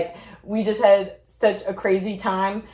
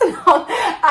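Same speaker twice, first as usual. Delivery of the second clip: short real laugh; 10 semitones higher than usual. Real, not acted. Laughing at own story.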